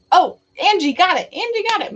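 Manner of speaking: high, loud delighted cry; thrilled someone guessed; excited. Real, not acted. Viewer guesses correctly.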